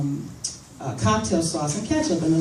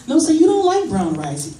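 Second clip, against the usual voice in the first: high sassy challenge; 10 semitones higher, 5 dB louder than usual. Retold or imitated. Waitress or aunt challenging kid.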